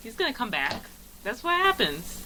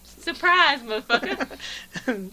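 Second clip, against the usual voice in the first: high, loud start with a stretched ending; mock catchphrase; 6 semitones higher, 5 dB louder, much slower than usual. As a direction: pounce on it like a movie tough guy. Riffing on actor's catchphrase.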